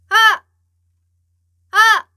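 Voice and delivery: loud, high open "ah!" pushed from the belly; supported belly shout. Shown as a demonstration. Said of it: "이렇게 나가야 되지".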